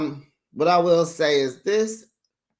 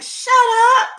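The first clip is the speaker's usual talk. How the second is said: high, sharp exasperated cry; exasperated shut up; 8 dB louder than usual. Retold or imitated. Recapping Tisha's scene to viewers, a squeaky exasperated shut up. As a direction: cut her off, squeaking in disbelief.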